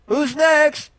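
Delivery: loud call up the shaft; yelling. Acted. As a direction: shout it up the rope.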